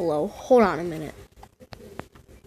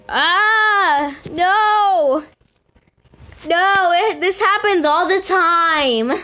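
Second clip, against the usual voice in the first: exasperated cry; 7 semitones higher, slower than usual. Real, not acted. Gamer wailing at the jammed car, high exasperated cry. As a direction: wail that it broke again.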